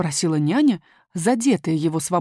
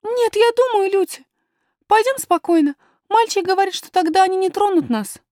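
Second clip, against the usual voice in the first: put-on very high child's voice; calm the nurse as a small girl; high-pitched, earnest little princess; little princess, reassuring; 12 semitones higher, 5 dB louder than usual.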